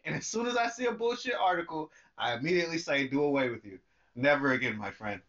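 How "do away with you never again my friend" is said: low, lordly farewell; mock formal dismissal. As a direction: dismiss it grandly, voice dropping low. Dismissing bullshit articles.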